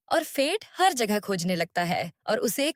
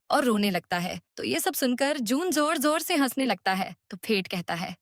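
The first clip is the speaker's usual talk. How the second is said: usual speaking voice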